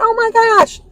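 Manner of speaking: high, startled oh my gosh; mother spotting the mouse, surprised; mock startled gasp. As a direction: play the startled mom, pitched well up. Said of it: "my mom went".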